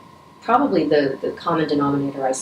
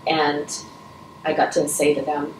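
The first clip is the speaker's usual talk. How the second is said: ordinary talk, nothing marked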